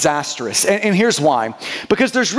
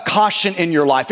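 ordinary talk, nothing marked

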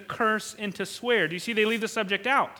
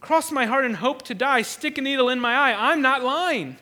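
insistent, slightly raised playground swearing; schoolyard oath; 3 semitones higher, 5 dB louder than usual. Acted. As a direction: swear it like an accused schoolkid, emphatically. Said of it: "this is my elementary school translation".

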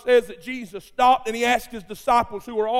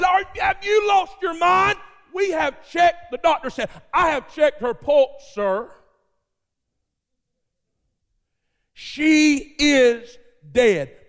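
scoffing shout; 6 semitones higher, slower than usual. Acted. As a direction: shout him down as if he's mad. Crowd and doctor scoff at Jesus.

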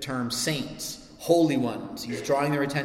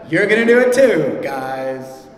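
teasing warning; 12 dB louder than usual. Real, not acted. Preacher teasing the kids in the pews. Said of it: "teasing warning to kids".

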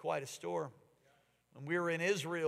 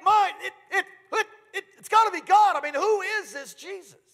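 fiery preaching; 13 semitones higher, 12 dB louder than usual. Acted. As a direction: preach it to the back row. Preaching at full force.

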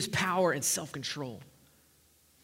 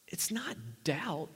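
slow unvoiced whisper; 4 dB quieter, slower than usual. Real, not acted. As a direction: hold it back as a secret. Whispered teaser before the point.

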